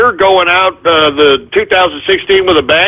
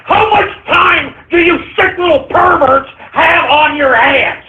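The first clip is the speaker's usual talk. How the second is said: angry rant; 7 semitones higher than usual. Real, not acted. Host ranting at disgusting caller.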